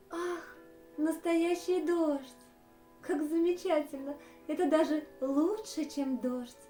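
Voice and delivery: high, loud burst of joy; delighted duck. Acted. Duck thrilled by the elephant's homemade rain, delighted. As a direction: gasp with delight, then exclaim. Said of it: "обрадовалась Миранда".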